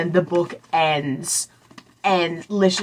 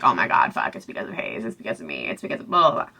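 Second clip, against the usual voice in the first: low, very soft mock muttering; half-voiced, trailing mock fretting; retold fretful muttering; 9 semitones lower, 8 dB quieter, much faster than usual. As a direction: mutter it under your breath, imitating someone blaming herself. Retelling Celine's fretful self-blame.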